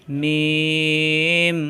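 মিম with a long held humming m from closed lips; মিম drawn out, lips closed then released; demonstrating the letter sound. Shown as a demonstration. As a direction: hold the m of মিম long, lips pressed together. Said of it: "দুই ঠোঁট থেকে উচ্চারণ".

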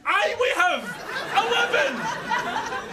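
high exasperated retort, last word drawn out; exasperated yell. Acted. Man answering the lift, drawn-out exasperated yell. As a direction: yell it back, stretching the final word.

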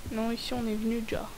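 fast low whisper. Real, not acted. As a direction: whisper so nobody hears. Whispering in the game.

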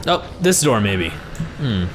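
ordinary talk

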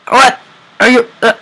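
high loud startled what; loud shocked question; real surprise. Real, not acted. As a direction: blurt out in shock. Shocked by game event.

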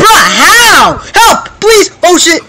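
panicked high yelling; being chased. Real, not acted. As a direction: scream for help in panic. Being chased, crying for help.

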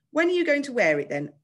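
high, loud taunt; jeering posh schoolboy; mocking question. A narrator's reading. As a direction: needle him with pointed curiosity. Bully mocks boy's safety helmet.

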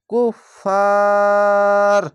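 কুফার with very long held "faa", stopped on "r"; stopping elongation. Shown as a demonstration. Teacher showing where to stop, very long "faa" in কুফার. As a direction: hold the "aa" in কুফার, then stop. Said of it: "থামা ভালো".

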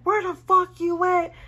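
mock yell down the phone, high and loud; retold screaming. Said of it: "Chris started screaming at me".

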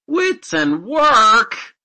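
high, very loud, drawn-out scoff; slow, scornful exclamation at full voice; indignant cry. A narrator's reading. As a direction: throw it back in outrage. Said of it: "cried the indignant godson".

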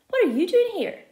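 loud, high excited question; excited shout. A narrator's reading. Felix shouting to friend who turned up, excited. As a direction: shout it across to him, thrilled. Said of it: "I shout".

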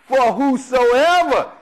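"whosoever" slowed down; stressed whosoever. Shown as a demonstration. Preacher pointing the flock to "whosoever", slowed. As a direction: linger on "whosoever". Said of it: "whosoever is referring to who?".

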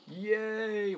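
high, drawn-out little cheer; playful cheer. Real, not acted. Player counting a few kills, playfully cheering. Mock cheer after small success.

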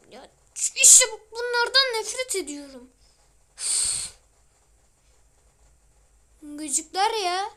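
high, loud, slow annoyed rant; annoyed outburst. Real, not acted. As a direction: spit it out in irritation. Hates the zombies.

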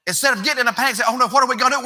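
rising pulpit preaching. Real, not acted. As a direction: lift the congregation with fervor. Preaching crescendo.

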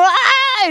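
high-pitched overwhelmed "ah!"; mock wail of being overwhelmed; grito de agobio. Imagina cargar con otra vida. (grito agudo de agobio fingido)

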